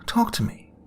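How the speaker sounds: woman pleading through the glass with the injured man; frightened pleading. A narrator's reading. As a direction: beg him to answer, near panic.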